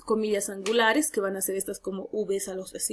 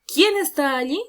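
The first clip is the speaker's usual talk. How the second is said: higher, louder questioning call; lightly voiced character line, raised and alert; Pedro asking; 5 semitones higher, 6 dB louder than usual. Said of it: "dijo Pedro".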